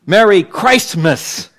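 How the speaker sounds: Merry Christmas slow and loud, long I in CHRIST and the T sounded; emphasize Christ. Shown as a demonstration. Preacher modelling how to say it to store clerks, loud and slow. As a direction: bellow CHRIST-mas slowly, leaning hard on the I. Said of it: "Make the I loud. Pronounce the T".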